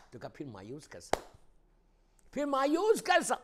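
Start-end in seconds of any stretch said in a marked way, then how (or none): 2.3-3.4 high, loud challenge; ringing rhetorical question, voice raised; impassioned shout. Acted. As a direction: cry it out from the pulpit. Repeats question at full force.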